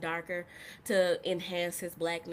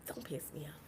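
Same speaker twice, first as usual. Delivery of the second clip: much softer whispered warning; angry whisper; 4 semitones lower, 13 dB quieter, faster than usual. Real, not acted. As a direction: hiss it under your breath. Muttering anger to self.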